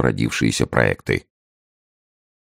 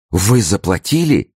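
high, distressed question; despairing cry; 7 semitones higher than usual. A narrator's reading. Wife to the superintendent who paid, in despair. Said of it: "вскричала в отчаянии".

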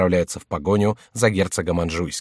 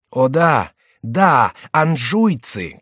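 loud, slow vengeful cry; shouting; 5 semitones higher, 5 dB louder, much slower than usual. A narrator's reading. Favourite shouting vengefully against the Angevins. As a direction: cry out for revenge. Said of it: "кричал Келюс".

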